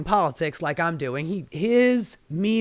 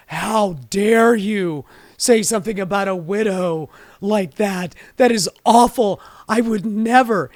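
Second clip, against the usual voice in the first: mocking outraged senator; 5 dB louder than usual. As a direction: ham up a pearl-clutching senator's outrage. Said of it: "And he's like".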